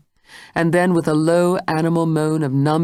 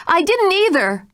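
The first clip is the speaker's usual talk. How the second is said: even higher, louder frightened cry; terrified denial; 4 dB louder than usual. Acted. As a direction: deny it, terror rising. Jane denies in terror.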